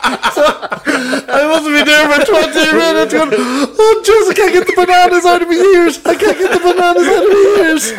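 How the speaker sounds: high-pitched, squeaky telling through laughter; helpless laughing. Real, not acted. Telling friends about the meditation gone wrong, laughing helplessly. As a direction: barely get it out for laughing.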